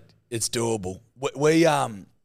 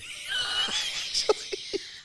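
very high, squealing laugh; helpless squeal of laughter; laughing fit; 3 dB quieter than usual. Real, not acted. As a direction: break off into a high cackle. Breaks off mid-sentence laughing.

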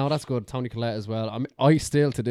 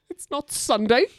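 high, loud mock-stern reply through a laugh; quoting Toni Collette; 14 semitones higher, 7 dB louder, slower than usual. Friend quoting the film mum's refusal, high and laughing. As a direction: snap it like a weary mum refusing the cereal. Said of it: "And she goes".

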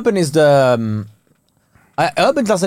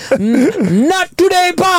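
much higher, hammy bouncer line; mock tough bouncer; 13 semitones higher, much slower than usual. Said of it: "Deliver the line again".

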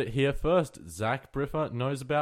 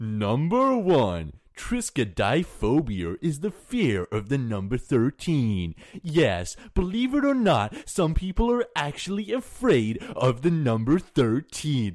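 hammed-up countdown narrator with swooping pitch; Watch Mojo. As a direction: parody a list-video host, big rises and drops. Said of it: "Do you like my Watch Mojo voice?".

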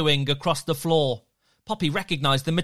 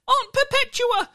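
gasp; 3 dB louder than usual. Acted. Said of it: "Peter gasped".

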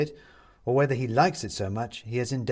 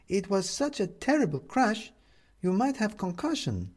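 worried neighbour woman; 11 semitones higher than usual. A narrator's reading. Upstairs neighbour finding him on the floor, worried.

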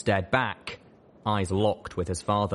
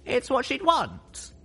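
high, earnest pony, slowing; young filly voice; slower than usual. Acted. Lil Pip earnest.

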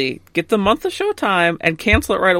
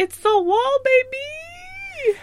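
excited hype; 4 dB quieter, much slower than usual. Real, not acted. Co-host hyping the episode title.

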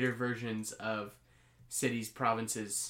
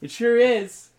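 higher, louder salesman's assurance; hard sell ad; 9 semitones higher, 10 dB louder than usual. Acted. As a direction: punch it like a pitchman. Salesman in parody ad.

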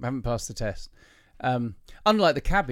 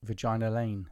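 ordinary talk, nothing marked

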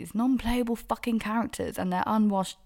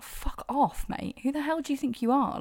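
indignant; 8 semitones higher than usual. Retold or imitated. Retelling furious reaction to his text.

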